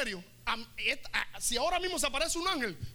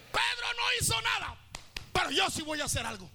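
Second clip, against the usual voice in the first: high defiant shout; 7 semitones higher than usual. Acted. Mary Magdalene declaring she will act, high defiant shout.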